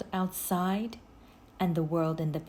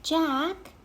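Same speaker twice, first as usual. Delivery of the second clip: put-on higher girl voice; Annie voice; 10 semitones higher than usual. A narrator's reading.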